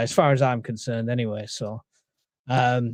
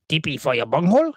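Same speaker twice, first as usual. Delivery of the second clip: Cornholio impression. Quoting a viewer's Beavis and Butthead line, mild cartoon voice. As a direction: drag out the second line like a dopey cartoon character. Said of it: "I don't know what accent that was supposed to be".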